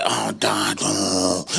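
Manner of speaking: low, quiet, mock-teenage groan; bored eye-rolling groan. Bored son watching his dad preach, eye-rolling groan. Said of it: "rolling his eyes".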